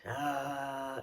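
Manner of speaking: low resonant ah from the chest; deep chest-voice ah. Shown as a demonstration. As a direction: feel it vibrate down in the chest. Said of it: "you might have to practice like that".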